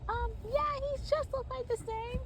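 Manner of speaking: very high character voice; high-pitched chatty reply; Smurfette. Acted. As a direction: answer in a squeaky high voice. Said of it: "Smurfette says".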